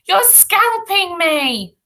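put-on boy crying out under attack. A narrator's reading. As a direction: protest slowly in a high voice. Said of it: "cried Ruskin".

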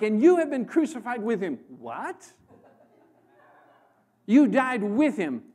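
loud, rising preacher's declarations; full-force preaching. Real, not acted. Preacher declaring to the congregation, loud and hammering.

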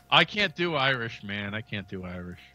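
ordinary talk, nothing marked

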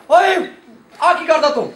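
high, loud acted confrontation; outraged acted shout; shouted challenge. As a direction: yell to stop a wrongdoer in the act. Rider confronts figure harming the cow.

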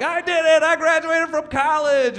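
mock triumphant post. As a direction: gloat about graduating.

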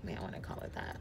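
breathy whispered aside; whispering. Real, not acted. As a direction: drop to a whisper for the joke. Whispered aside about the name.

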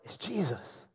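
soft, breath-held revelation; hushed realisation. Acted. John telling Peter who is on the beach, hushed realisation. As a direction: let it dawn on you softly. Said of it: "and John's like".

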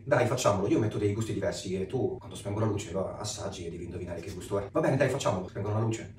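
ordinary delivery

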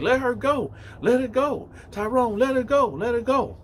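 high, insistent acted yelling; urgent intervention. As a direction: plead urgently to stop a fight, as retold. Girl intervenes in a fight.

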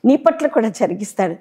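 normal talking voice

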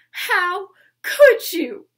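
loud, high, drawn-out wail; wailing, tearful reproach; sobbing. Acted. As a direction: accuse through hammed-up sobs. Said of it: "she sobbed".

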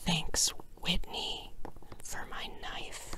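slow whispered thanks; soft grateful whisper. Real, not acted. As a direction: murmur it like a private thank-you.

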